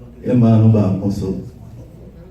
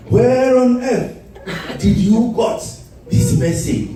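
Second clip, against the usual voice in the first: mock questioning; 11 semitones higher than usual. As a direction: drag it out in a mock high voice. Repeats the quote, higher.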